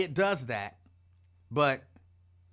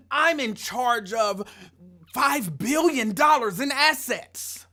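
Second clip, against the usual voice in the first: slow, booming, higher boast; mock loud real-estate big shot; loud braggart; 4 semitones higher, 6 dB louder, slower than usual. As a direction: announce it to the whole room, word by word. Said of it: "Why you the only one talking this loud".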